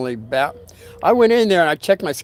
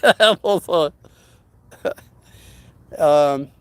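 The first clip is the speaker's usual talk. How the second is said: laughing; 4 dB louder, much slower than usual. Real, not acted. Laughing mid-sentence.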